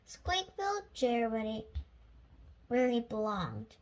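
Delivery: ordinary delivery, nothing marked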